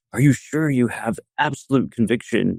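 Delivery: lightly acted grilling, pressing for an answer; mildly berating. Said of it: "sort of berate you".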